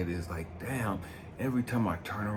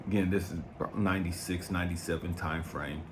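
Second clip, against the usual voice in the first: usual speaking voice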